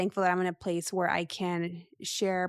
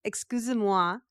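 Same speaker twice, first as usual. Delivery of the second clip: "Excusez-moi" said in French, ex-kew-zay-mwah; French phrase. Shown as a demonstration. Podcaster showing the app's French drill, French accent. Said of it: "And then you repeat it".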